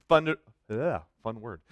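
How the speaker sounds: usual speaking voice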